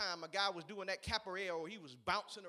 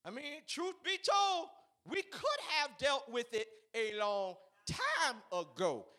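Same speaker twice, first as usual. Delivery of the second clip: ordinary talk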